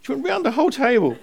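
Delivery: high, loud, laughing mum impression; laughing through Mum's words. Son retelling Mum's confession at the table, laughing. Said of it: "and Mum said".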